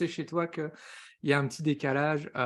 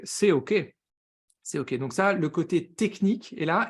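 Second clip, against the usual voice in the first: ordinary talk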